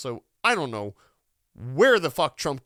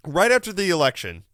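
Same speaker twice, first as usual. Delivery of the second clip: ordinary talk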